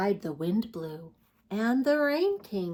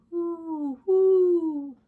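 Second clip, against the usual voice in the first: high hooting wind noise; howling wind; 9 semitones higher, 5 dB louder than usual. Acted. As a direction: make the wind howl. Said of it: "the wind blew".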